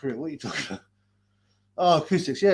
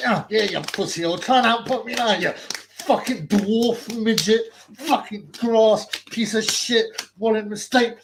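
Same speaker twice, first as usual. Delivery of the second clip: slow, higher jeering threats; mock taunting; 6 semitones higher, 4 dB louder, slower than usual. Playing the attackers taunting the rival, slow hammed jeer.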